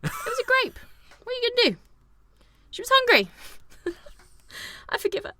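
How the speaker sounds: very high, small pleading voice; mock-pitiful high voice; squeaky mock plea. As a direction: squeak out a playful defence. Squeaky mock plea defending a film character.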